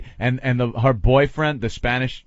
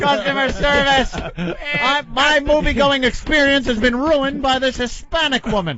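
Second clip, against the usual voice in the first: yelling at phone menu; 10 semitones higher, slower than usual. Pretend.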